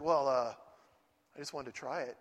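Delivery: low, soft sheepish reply; sheepish, a little hurt; wife, meek. Retold or imitated. Wife answering meekly.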